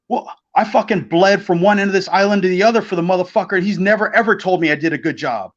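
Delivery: high-pitched imitation of an aggrieved friend; indignant mock rant. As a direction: protest in mock outrage, starting high and easing down. Carino's indignant complaint, acted out.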